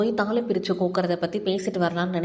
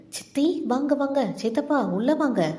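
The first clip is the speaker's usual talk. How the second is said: high, delighted greeting; eager welcome; 6 semitones higher than usual. A narrator's reading. Girl invites aunt and uncle inside, eager and affectionate. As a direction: call the visitors in eagerly. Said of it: "ஆசையாய் அழைத்தாள்".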